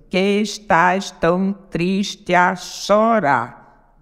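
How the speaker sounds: very slow, syllable by syllable; contando sílabas. Shown as a demonstration. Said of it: "Sete sílabas". (escandindo devagar, sílaba por sílaba)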